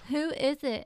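put-on begging younger girl. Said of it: "She begged and begged".

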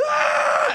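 sudden high ghost scream; mock ghost shriek. Telling friends about the prank, a sudden ghost shriek. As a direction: shriek like the ghost girl jumping out. Ring girl jumps at victims.

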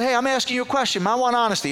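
high, loud, quick pressing; insistent demand. Retold or imitated. Caller pressing a silent receptionist, insistent. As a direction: press hard for an answer.

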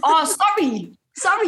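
broad Geordie "sorry", pitched high; Geordie accent. Shown as a demonstration. Guest demonstrating for host, her Geordie accent. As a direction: say "sorry" as Geordie "sorry" with open vowel. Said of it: "So in, in my accent".

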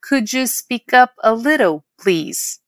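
slow, connected "Could you speak up a little", dropping on "please"; whole model phrase. Shown as a demonstration. Teacher modelling a request for volume, slow and connected. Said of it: "Vamos praticar essa pronúncia?".

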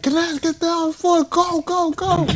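high frantic mom shouting; mother screaming at her son to stop; mom yelling. Retold or imitated.

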